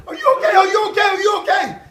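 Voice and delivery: high, loud repeated frantic question; frantic worry. Retold or imitated. Mother running back to fallen son, frantic and loud. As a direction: plead like a scared mother. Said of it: "she said".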